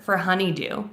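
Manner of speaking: normal talking voice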